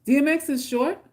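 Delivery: normal talking voice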